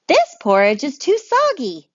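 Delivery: a fussy bear complaining; fussy bear turning up his nose; bear tasting porridge. Acted. Bear voiced in read-aloud story.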